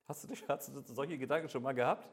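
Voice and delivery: ordinary talk, nothing marked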